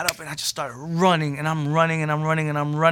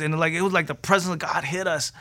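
ordinary delivery

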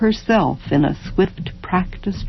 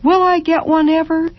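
put-on longing child; 11 semitones higher than usual. A narrator's reading. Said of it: "child asking longingly".

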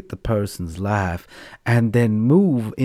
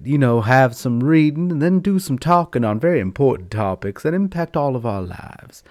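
usual speaking voice

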